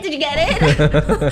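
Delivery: slow, laughing through the words; laughing imitation. Teller mimicking Zizi's voice message, laughing. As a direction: crack up while mimicking. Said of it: "sending voice messages".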